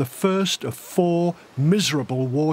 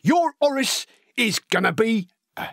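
lively, wide-eyed working-class lad spinning war tales; excitable storytelling with big pitch leaps; eager young Cockney recruit; 4 semitones higher than usual. Acted. Horace telling Elsie gruesome war stories, eager and excitable.

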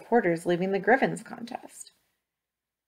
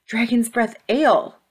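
gasp, then a higher, louder exclamation; exclaimed realisation; 4 semitones higher, 4 dB louder than usual. A narrator's reading. Ivy recognises the smell in the sniffed mud, exclaiming. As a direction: gasp as it dawns, then burst out with the name. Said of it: "she exclaimed".

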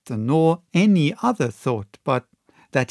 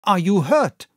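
cried; 6 semitones higher, 4 dB louder than usual. A narrator's reading. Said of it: "cried I".

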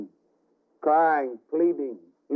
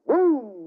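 thunder boom; 9 semitones higher than usual. Acted. Preacher imitates thunder.

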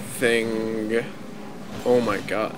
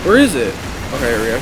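ordinary talk, nothing marked

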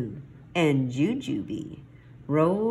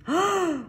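quick high gasp; shocked gasp. A narrator's reading. Kitty shocked at the destination.

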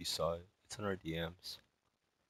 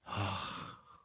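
long breathy sigh. Real, not acted. Long sigh before asking.

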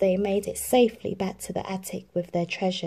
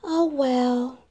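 higher, wistful sigh; resigned Cinderella; 6 semitones higher than usual. A narrator's reading. Cinderella sadly talks to herself. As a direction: shrug it off sadly. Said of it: "she said to herself".

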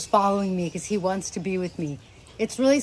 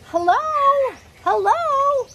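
imitating parrot; 6 dB louder than usual. Parrot greeting on the stairs.

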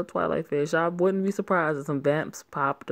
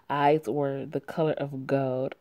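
normal talking voice

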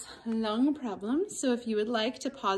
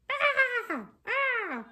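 high startled puppet shriek; a puppet yelps in fright; puppet scream; 11 semitones higher than usual. Acted. Puppet sees rat.

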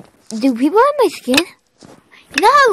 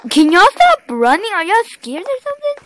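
high, raised-voice complaint; exasperated calling out; 10 semitones higher, 5 dB louder than usual. Real, not acted. Gamer calls after players who keep running, exasperated. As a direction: start loud, climb higher into the question.

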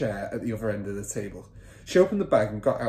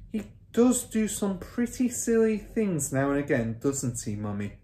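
Matilda's voice; 6 semitones higher than usual. A narrator's reading. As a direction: remark innocently to Mummy.

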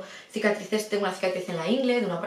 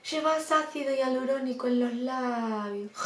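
slow, softer, imitated Argentine-like accent; possibly Argentine accent; 2 semitones higher, 4 dB quieter, slower than usual. Surgeon to patient drifting off, slow Argentine-sounding accent. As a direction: drawl it out in a mock Argentine accent. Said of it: "habla así como un acento tal".

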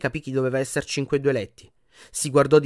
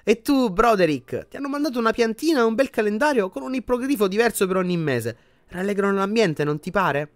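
higher, chirpy nurse voice; cheerful healer; 8 semitones higher than usual. A narrator's reading. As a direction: cheer up the patient brightly. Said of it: "disse la guaritrice all'uomo". (guaritrice allegra con voce acuta)

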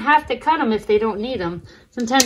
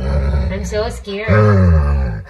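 mock-scared, much lower and louder, slow; 6 dB louder, much slower than usual. Mocking the growling dog, mock-scared in a deep loud voice. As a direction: pretend to be terrified of the growling dog.

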